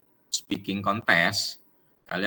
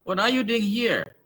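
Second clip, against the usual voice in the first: higher, bright greeting voice for Santi; Santi greeting Deka; 11 semitones higher than usual. Acted. Teacher reads Santi's greeting to Deka, higher. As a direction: play Santi surprised to see Deka. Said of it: "Santi ke Deka, dia nyapa".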